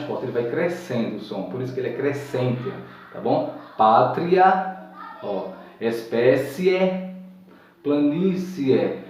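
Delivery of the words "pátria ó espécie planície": slow, stretched Pátria, espécie, planície with a sing-song lilt; pátria espécie planície, slow sung-out model; drawn-out demo words. Shown as a demonstration. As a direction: drag out each word to show the strong vowel. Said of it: "o E ... pronunciada com mais força".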